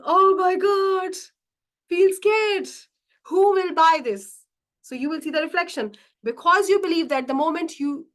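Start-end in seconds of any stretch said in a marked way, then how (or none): 0.1-4.4 high, loud mock panic, words drawn out; scared inner voice. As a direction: act out an alarmed inner protest, pitched up. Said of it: "Your spiritual being is saying".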